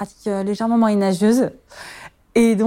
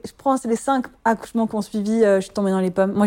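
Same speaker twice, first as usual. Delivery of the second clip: usual speaking voice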